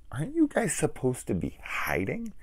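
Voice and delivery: high-pitched puzzled question; mock incredulous. Viewer questioning noisy characters in hiding, high mock incredulity. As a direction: ask it way up high, in mock disbelief. Incredulous at loud hiders onscreen.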